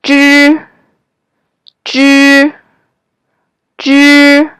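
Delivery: zhi with the tongue tip curled back; retroflex zhi. Shown as a demonstration. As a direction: say zhi slowly with tongue curled against the palate. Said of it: "Let's try it".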